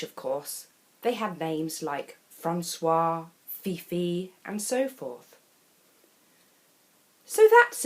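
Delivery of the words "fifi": plain reading voice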